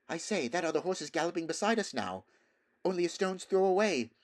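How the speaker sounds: put-on a frightened boy calling out mid-gallop; boy alerting Bree while galloping; urgent boy. Shasta alarmed while galloping.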